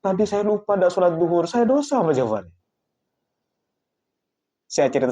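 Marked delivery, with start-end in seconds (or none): 0.0-2.5 high-pitched, lightly acted distress; imitating an upset, weeping man; retold tearful confession. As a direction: confess a lapse as if close to tears.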